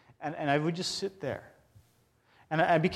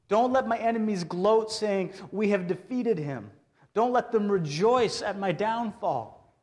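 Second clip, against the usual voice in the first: raised, pleading lament read higher and louder; impassioned psalm reading; 4 semitones higher, 4 dB louder than usual. Acted. Preacher to congregation, reading a lament psalm with passion. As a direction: cry out the psalm like a desperate prayer.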